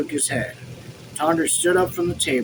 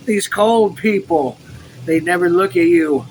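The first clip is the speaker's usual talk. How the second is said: louder, distressed complaint; with emotion; 3 semitones higher, 6 dB louder than usual. A narrator's reading. Officer lamenting the cold townspeople, emotional outburst. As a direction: let the upset push the volume up. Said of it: "he spoke with emotion".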